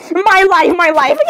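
high cry with giggly squeal; squealing meltdown. Acted. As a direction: exclaim it high, then squeal with laughter. Overwhelmed squealing in skit.

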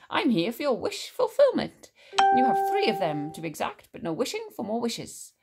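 animated, high swooping salesman-like genie; showy genie patter. A narrator's reading. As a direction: announce it with theatrical lilts. Genie explains the wishes.

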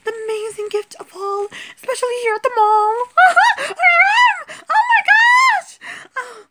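high doll voice shrieking with laughter; too excited. Acted. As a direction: shriek with over-the-top joy. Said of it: "he was just too excited".

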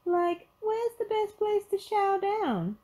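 very high, slow cartoon voice; goofy high-pitched sidekick; Shaggy. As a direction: ask it high and drawn out. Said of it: "Shaggy turned to a security guard".

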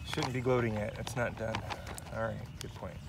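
ordinary talk

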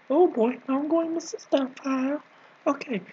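ordinary talk

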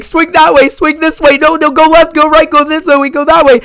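high, loud, urgent shouting of directions; retold yelling. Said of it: "they're both yelling at Peter".

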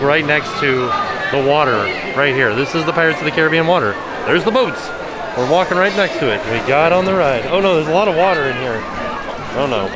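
usual speaking voice

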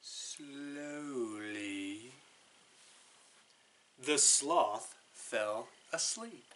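ordinary delivery, nothing marked

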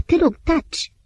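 choked, high plea, barely recognizable; strangled voice. A narrator's reading. Antim silences Maria, strangled and high. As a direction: force it out through a tight throat. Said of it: "cu un glas sugrumat, aproape de nerecunoscut".

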